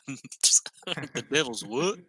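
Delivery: slowed, stretched Southern question; hammed-up Southern drawl, slow; southern accent. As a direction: imitate the Southern question, drawn out. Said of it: "my voice went really southern".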